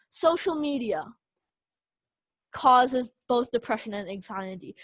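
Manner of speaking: long, awkward pause after "Social media". Shown as a demonstration. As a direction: stop dead after "media", then resume with "causes". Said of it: "a very awkward pause".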